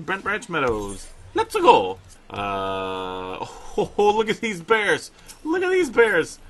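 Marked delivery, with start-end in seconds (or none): 1.3-2.0 bright burst of eagerness, louder; excited. Real, not acted. Eager start of the trip. 3.8-6.4 high, loud delighted gushing; excited. Real, not acted. Gushing over the bears.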